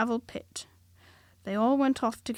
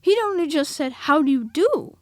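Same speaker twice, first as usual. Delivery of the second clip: higher, louder Rabbit, affronted; indignant Rabbit; 6 semitones higher, 11 dB louder than usual. A narrator's reading. Rabbit to Christopher Robin, higher indignant Rabbit voice.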